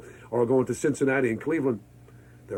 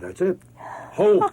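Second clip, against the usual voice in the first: retold exclamation; 7 semitones higher, 4 dB louder, slower than usual. Retold decision to leave Miami.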